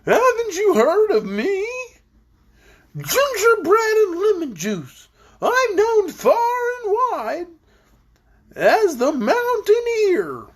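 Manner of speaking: high, slow voice bobbing up and down; bumpy voice. A narrator's reading. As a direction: make every word wobble over bumps. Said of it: "said in a rather bumpy voice".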